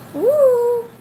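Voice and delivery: very high, loud, sustained woo; high coaxing woo. Real, not acted. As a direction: hoot high and long to get the pet's attention. Noise made to coax bird.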